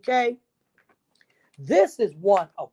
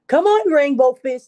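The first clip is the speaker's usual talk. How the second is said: higher, louder call to a friend; 6 semitones higher, 8 dB louder than usual. Acted. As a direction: call him over to play. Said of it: "they called".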